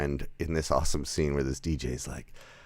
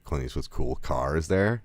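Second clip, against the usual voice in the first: ordinary delivery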